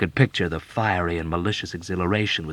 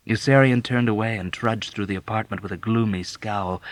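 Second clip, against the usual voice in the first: usual speaking voice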